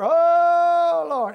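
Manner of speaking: high, loud imitated wail to the Lord; mock wailing. Preacher imitating the youngest sister wailing at the funeral, loud and high. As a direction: wail it out like a grieving sister, hammed up. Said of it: "she would wail and whoop and holler and scream".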